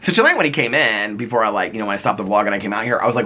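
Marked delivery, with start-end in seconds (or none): none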